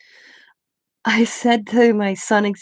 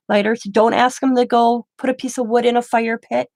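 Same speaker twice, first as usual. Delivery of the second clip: normal talking voice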